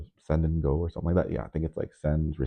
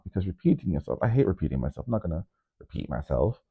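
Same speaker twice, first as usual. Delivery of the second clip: ordinary delivery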